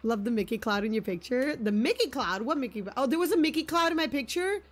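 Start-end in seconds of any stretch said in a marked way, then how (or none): none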